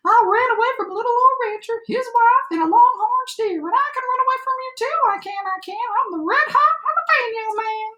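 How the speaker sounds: high-pitched gingerbread cowboy voice; boastful cowboy shout. A narrator's reading. Gingerbread cowboy brags to the animal chasing him, high boastful shout. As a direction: crow it high, like a bragging runaway. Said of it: "he shouted".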